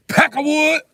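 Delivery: high, loud yelled "Peckerwood"; shouted insult. Retold or imitated. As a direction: fling "Peckerwood" out in a high yell. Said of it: "I'm like".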